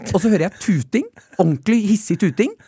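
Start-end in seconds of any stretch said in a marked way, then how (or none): none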